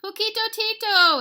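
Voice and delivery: very high, loud recognition; delighted exclamation. Acted. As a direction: cry out on spotting an old friend. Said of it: "exclaimed Scapito".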